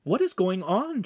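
high, demanding question; insistent, high-voiced character. A narrator's reading. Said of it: "Chimera demanded".

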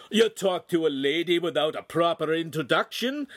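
big man's indignant bellow. A narrator's reading. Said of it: "the big man bellowed".

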